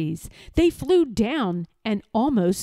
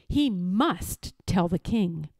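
plain reading voice

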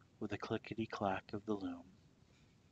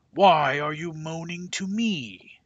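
angry king; 9 semitones higher, 17 dB louder than usual. A narrator's reading. King shouting at villagers.

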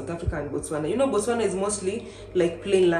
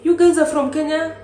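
surprised question; 9 semitones higher, 7 dB louder than usual. Retold or imitated. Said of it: "he was like".

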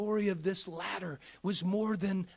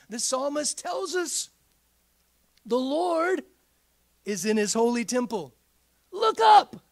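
soaring sermon climax; preaching at full force; 6 semitones higher, 10 dB louder than usual. Acted. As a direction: lift the congregation with every word. Pastor builds to a shout.

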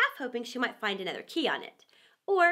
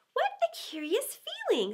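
put-on much higher, amazed little-girl voice; wondering Alice; 12 semitones higher than usual. A narrator's reading. Alice feeling herself shrink, amazed and wondering. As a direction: marvel at the strange feeling. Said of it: "said Alice".

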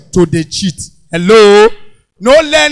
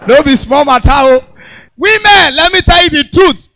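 loud; 4 semitones higher, 5 dB louder than usual. Real, not acted. Comedian calls out to women.

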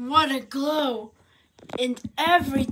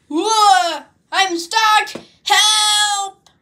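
panicked shouting; 7 semitones higher, 8 dB louder, much slower than usual. Acted. Stuck up high.

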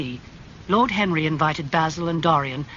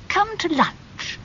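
put-on higher, surprised old aunt; Lady Agatha voice; slower than usual. A narrator's reading. Aunt greeting her nephew, high and surprised. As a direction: tease him with mock surprise.

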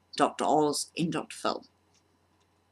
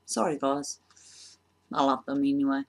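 normal talking voice

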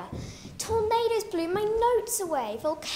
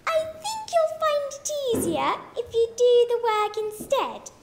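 a lightly voiced teacher, louder and higher; a hint of a know-it-all teacher, firm; teacher setting the pupil straight, lightly voiced; teacher's voice; 5 semitones higher, 4 dB louder than usual. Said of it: "my teacher said".

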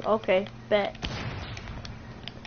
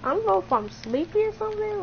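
higher, quick repeat; playful self-mimicry; 6 semitones higher, faster than usual. Player echoing own sleepy line to friend, higher playful replay. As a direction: lift the pitch and rattle it off.

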